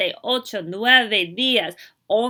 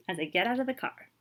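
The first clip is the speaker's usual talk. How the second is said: usual speaking voice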